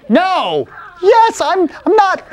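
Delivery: high, loud back-and-forth bickering; acted squabble. Sister and Jacob arguing over the cat, loud bickering. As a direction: blurt it out in a heated childish argument.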